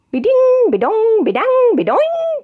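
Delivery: high, loud bouncy be-ding be-dong; bouncing sound words. A narrator's reading. Narrator sounding the car jolting on the bumpy road, high and loud.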